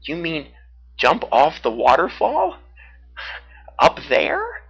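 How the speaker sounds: high, startled question; disbelieving, pitch climbing; surprised girl. A narrator's reading. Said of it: "she asked".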